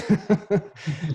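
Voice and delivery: short burst of laughter; bright laugh. Real, not acted. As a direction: laugh it off warmly. Laugh after farewell line.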